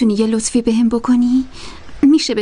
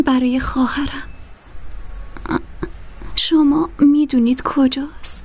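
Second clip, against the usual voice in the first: whisper. Acted. Girl asks the madam about her sister, whispering. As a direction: barely breathe the question.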